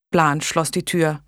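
plain reading voice

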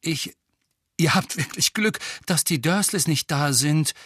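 plain reading voice

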